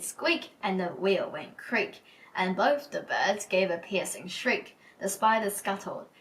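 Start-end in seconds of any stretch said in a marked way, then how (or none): 0.0-0.5 high, squeaky little mouse; mouse squeak. Acted. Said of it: "And the mouse said".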